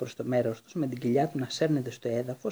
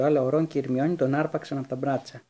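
ordinary delivery, nothing marked

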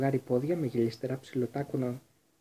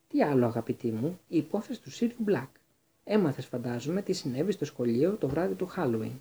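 ordinary delivery

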